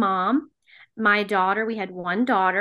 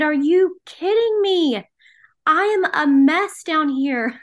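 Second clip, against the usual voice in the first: high, slow, incredulous protest; retold desperate yelling; 7 semitones higher, 4 dB louder than usual. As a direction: act out arguing with God, stretching the words. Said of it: "I was yelling at God".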